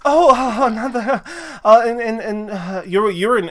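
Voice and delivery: high, stammering excitement; starstruck, high-voiced fumbling; flustered delight. Wife thrilled to meet another genasi.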